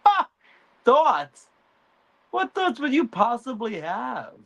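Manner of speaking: high, scoffing mother voice; scornful Alicent. Retold or imitated. Alicent scoffs at Aegon in high scornful voice. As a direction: laugh off his thoughts. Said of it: "She's like".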